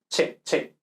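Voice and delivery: che with a Spanish "ch" as in chaval. Shown as a demonstration. Teacher isolates the Spanish sound, che with ch. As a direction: demonstrate che with a clear ch sound. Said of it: "Esto es la che española".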